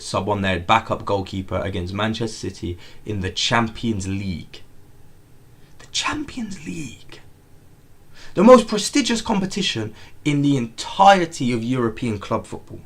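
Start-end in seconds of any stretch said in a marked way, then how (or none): 5.8-7.2 breathy whisper of disbelief. Real, not acted. Whispering to chat about the backup keeper. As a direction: hush the words in shock.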